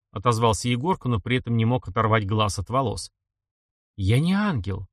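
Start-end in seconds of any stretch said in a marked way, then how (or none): none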